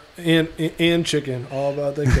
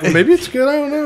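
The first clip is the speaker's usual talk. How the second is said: imitating himself; 11 semitones higher, 3 dB louder than usual. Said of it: "I'm like".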